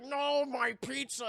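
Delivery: high, slow comic lament; drawn-out, overdramatic dismay; mock anguished wail. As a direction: cry it out in hammed-up despair. Man mourns spilled pizza, acted.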